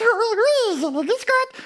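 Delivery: put-on very high, childlike creature voice; little dog character. Little dog answering the host, high creature voice. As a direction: squeak it politely, very high.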